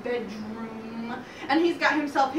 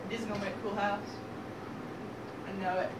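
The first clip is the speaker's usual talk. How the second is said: soft, low, understated cool piglet; laid-back second pig; 5 semitones lower, 10 dB quieter, much faster than usual. Acted. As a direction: murmur it with quiet confidence. Second pig admires sticks.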